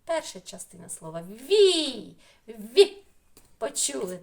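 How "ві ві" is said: long, loud ві, then a short punched Ві; sounding out a syllable. Shown as a demonstration. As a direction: stretch ві, then repeat it sharply. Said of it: "Перший склад". (склад ві протяжно й голосно)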